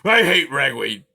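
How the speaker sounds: loud, grumbling porcupine growl. A narrator's reading. Aerith grumbling about Poppy's ragweed talk, growling. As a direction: growl out your complaint. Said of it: "he growled".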